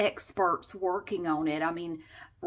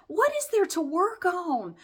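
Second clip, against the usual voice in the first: incredulous mock repeat; 8 semitones higher, slower than usual. Mocking the agent's line.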